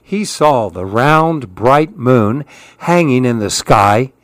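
usual speaking voice

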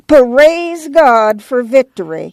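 put-on slow, high bleating old woman; slow reverent bleat. A narrator's reading. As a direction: drag it out like a quavering old granny.

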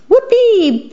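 pure delight in the ride; joyful squeak. A narrator's reading. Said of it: "Pip squeaked".

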